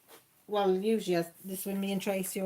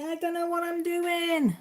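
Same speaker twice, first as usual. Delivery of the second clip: high, loud, helpless cry of confusion; mock helpless wail; 10 semitones higher, 8 dB louder than usual. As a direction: squeak it out like you're totally lost. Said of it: "and say".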